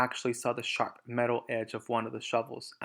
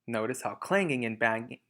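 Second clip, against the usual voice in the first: ordinary delivery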